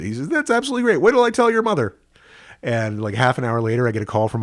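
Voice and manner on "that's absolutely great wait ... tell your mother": much higher, beaming voice of the father; father bursting with delight at the news. Retold or imitated. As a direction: play the overjoyed dad on the phone. Father voiced in retold phone call.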